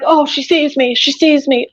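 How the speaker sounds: a light mock of the voices taunting. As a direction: tease like a gloating tormentor. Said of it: "And they're like".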